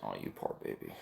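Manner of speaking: breathy, teasing pity; mock sympathy. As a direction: coo it like a fake nurse. Mocking friend's sensitive stomach.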